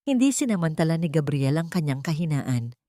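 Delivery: usual speaking voice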